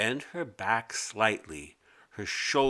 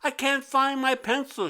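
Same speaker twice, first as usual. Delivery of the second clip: much higher, calling out to teacher; calling girl voice; 13 semitones higher than usual. A narrator's reading. Mimi raising her hand to tell the teacher, high call. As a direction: call out across the classroom, high. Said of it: "she called out".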